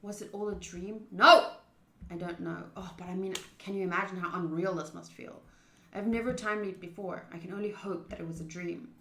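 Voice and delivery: normal talking voice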